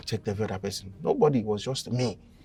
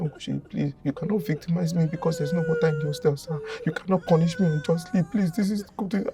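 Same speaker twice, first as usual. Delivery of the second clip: mock crying plea; 8 semitones higher, 5 dB louder than usual. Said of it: "Just started crying".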